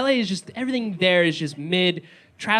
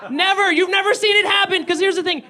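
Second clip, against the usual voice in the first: high, loud, exasperated outburst; exasperated rant; 11 semitones higher, 4 dB louder than usual. Real, not acted. Comic venting to the crowd, high and loud. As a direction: shout it up high like a fed-up ranter. Ranting at cops on horses.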